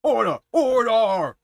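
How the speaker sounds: booming judge's shout. Acted. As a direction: bellow it to silence the court. Judge calls court to order.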